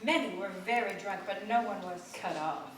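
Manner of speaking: ordinary delivery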